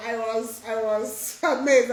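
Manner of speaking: ordinary delivery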